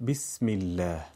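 "بسم الله" again with i as bism illa; short i in "بسم الله"; Arabic kasra i. Shown as a demonstration.

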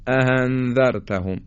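"اَأَنذَرْتَهُمْ" with an h-like second hamza, "a-han"; hamza softened to h. Shown as a demonstration. Teacher shows the mistake in اَأَنذَرْتَهُمْ, hamza like h. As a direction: replace the second glottal stop in "اَأَنذَرْتَهُمْ" with h.

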